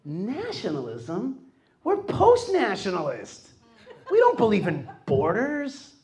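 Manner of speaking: incredulous German reporter, high and drawn out; mock German reporter. German reporter scoffing at nationalism, mock disbelief. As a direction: scoff like a shocked German journalist. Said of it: "They say".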